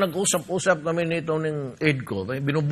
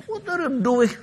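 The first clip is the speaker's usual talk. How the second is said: higher, put-on challenging voice; high mocking what are you doing; mock scolding; 10 semitones higher than usual. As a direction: needle them like a scold.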